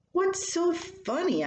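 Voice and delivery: high, slow, bewildered ask; puzzled question. Retold or imitated. Wife asks her laughing husband, high and puzzled.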